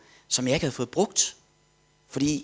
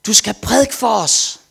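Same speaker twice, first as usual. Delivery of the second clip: high, loud, slow command; priest's loud call; 9 semitones higher, 10 dB louder, slower than usual. Retold or imitated. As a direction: announce it slowly and loudly to him. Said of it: "Og så sagde han".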